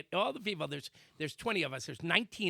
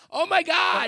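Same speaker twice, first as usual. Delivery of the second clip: high, loud panicked shriek; imitated woman's scream; 13 semitones higher, 11 dB louder than usual. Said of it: "now she's screaming".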